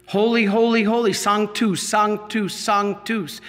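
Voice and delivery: usual speaking voice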